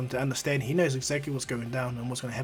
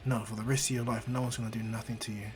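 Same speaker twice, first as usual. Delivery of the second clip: softer, gentle comfort; tender promise; 3 semitones lower, 6 dB quieter than usual. Acted. Izuku to Eri, soft tender promise. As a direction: promise her softly. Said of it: "He goes".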